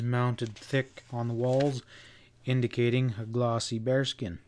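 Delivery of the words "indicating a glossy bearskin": ordinary delivery, nothing marked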